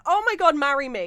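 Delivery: high, loud joking gush. Retold or imitated. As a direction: gush over a friend's outfit.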